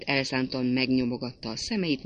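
ordinary delivery

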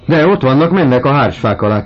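high, loud alarmed call; excited cry. A narrator's reading. Victorine crying out as she spots them in the garden. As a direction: cry out the news from the window. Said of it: "kiáltotta Victorine kisasszony".

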